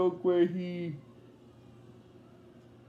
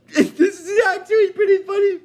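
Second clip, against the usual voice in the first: laughing; 12 semitones higher, 8 dB louder than usual. Real, not acted. Cracks up mid-retelling.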